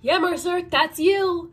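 high, eager prodding; excited nudge to a character; urging on. Real, not acted. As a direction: egg him on, voice lifted. Cheering a character on.

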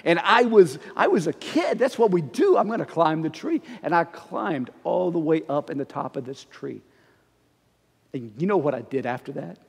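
ordinary talk

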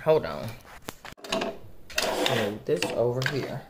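ordinary talk, nothing marked

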